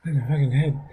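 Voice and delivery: soft, whiny moaning about a hurt hand; mock nurse a sore wrist; bully moaning about his sore hand, softly; complaining in pain. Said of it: "I hear him complaining".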